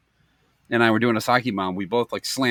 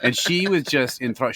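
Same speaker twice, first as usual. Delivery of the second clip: ordinary delivery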